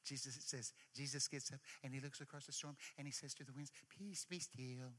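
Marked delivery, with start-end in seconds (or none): 4.0-4.9 soft, gentle recital; poetic. Retold or imitated. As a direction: read it like a tender poem. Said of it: "like a poem".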